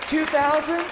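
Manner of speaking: light rising ask for a bid; questioning bid call. Acted. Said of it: "auctioneer raises bid".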